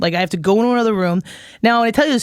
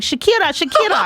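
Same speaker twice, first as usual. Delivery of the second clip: imitating vendors calling; 10 semitones higher than usual. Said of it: "they're like".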